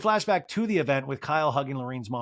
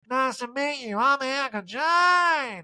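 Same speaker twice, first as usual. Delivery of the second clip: very high, slow, dazed diva calling out; Erica impression; 10 semitones higher, slower than usual. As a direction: drag out a lost, high calling voice. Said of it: "And Eric's like".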